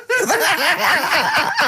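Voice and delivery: long high cackling laugh; real burst of laughter. Real, not acted. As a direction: crack up completely. Cracks up at the text message.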